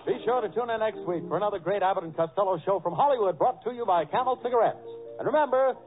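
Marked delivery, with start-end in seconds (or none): none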